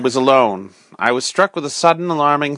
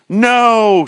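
much higher, louder full shout; shout at the top of his lungs; 9 semitones higher, 5 dB louder than usual. Acted. The mad curate shouts his refusal at the narrator. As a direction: bellow it at the heavens. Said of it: "shouted the curate at the top of his lungs".

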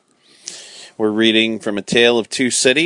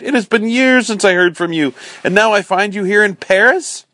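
much higher, eager reunion voice; overjoyed exclaiming; 10 semitones higher, 3 dB louder than usual. A narrator's reading. Miss Pross greets her brother in Paris, high and excited. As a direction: gush with delighted disbelief. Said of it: "she cried".

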